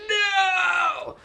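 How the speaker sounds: a high, thin mock scream of no; imitated cry of no. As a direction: yell the no in a high pitch like a desperate hero. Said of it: "Arata's like".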